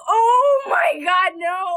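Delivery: high, loud, drawn-out mock shriek; retold horror. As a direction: imitate your own shocked cry. Acts her shock at being caught.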